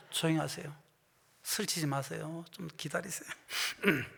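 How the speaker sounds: usual speaking voice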